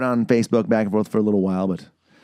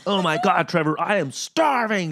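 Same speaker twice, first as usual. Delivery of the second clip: high, stretched-out whine of hunger; mock dramatic complaint; 8 semitones higher than usual. Imitating a comedian's starving friend, hammed-up high complaint. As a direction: overdramatize it, dragging out the key word.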